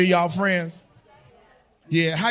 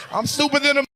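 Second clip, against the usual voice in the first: ordinary delivery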